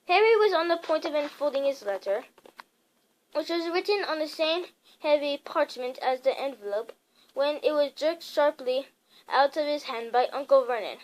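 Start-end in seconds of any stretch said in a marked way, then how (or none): none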